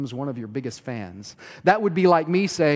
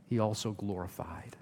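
breathy hushed whisper of "glorified"; a reverent whisper closing the verse; 6 semitones lower, 9 dB quieter than usual. Real, not acted. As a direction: let the verse fall to a whisper. Reading scripture, dropping to hush.

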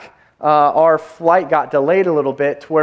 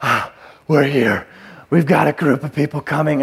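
mock out of breath; 2 semitones lower than usual. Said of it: "I'm, like, out of breath".

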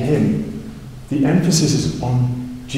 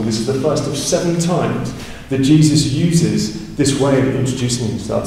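usual speaking voice

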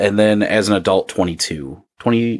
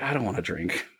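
muttered; 2 semitones lower, 12 dB quieter than usual. Retold or imitated.

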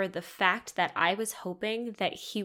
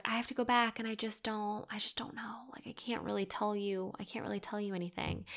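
hushed, half-whispered imitation of hedging; imitating evasive ex; 2 semitones higher, 8 dB quieter than usual. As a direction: trail into a near-whisper, mimicking his reluctance. Voices ex's evasive hedging.

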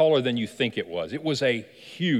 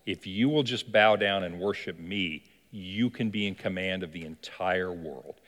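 lower, soft and slow tempting offer; seductive devil offer; 6 semitones lower, 4 dB quieter than usual. Acted. Devil tempts Jesus with the world, low, slow and soft. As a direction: lure him in, low and unhurried. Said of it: "He said".

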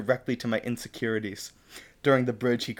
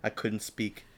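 ordinary talk

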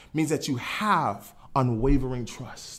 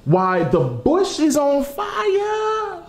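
amazed shout; 10 semitones higher, much slower than usual. Acted. Moses amazed at the bush.

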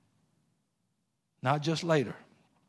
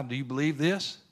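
ordinary delivery

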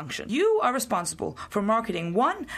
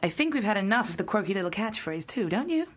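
ordinary talk, nothing marked

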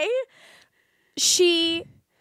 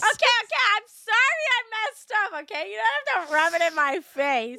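laughing; 8 semitones higher, faster than usual. Real, not acted. Embarrassed after flubbed word, laughing.

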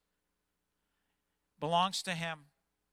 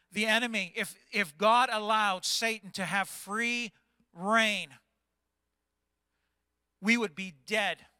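preaching; 5 semitones higher, 7 dB louder than usual. Real, not acted. Preaching at full force.

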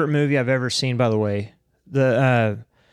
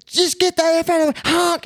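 mock angry shout. Angry driver quoted again.

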